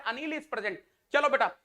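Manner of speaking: ordinary talk, nothing marked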